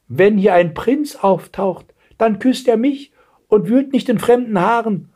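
put-on high, deliberate old-lady voice; withered Dornröschen. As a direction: insist like a vain aged beauty. (welke Schönheit, hohe Stimme)

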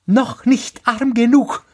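very high bird voice; taunting sparrow. A narrator's reading. (hohe, höhnende Spatzenstimme)

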